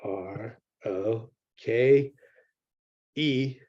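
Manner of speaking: letter by letter, very slow and deliberate; spelling out a word. Shown as a demonstration. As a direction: spell out "B R O K E" one letter at a time, pausing between each. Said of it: "It's how you spell it".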